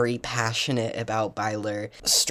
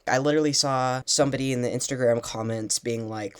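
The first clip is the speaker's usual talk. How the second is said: ordinary talk, nothing marked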